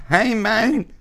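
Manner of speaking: squeaky high mimicry of the greeting; mocking high voice. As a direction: parrot it back high and goofy. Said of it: "wasn't funny".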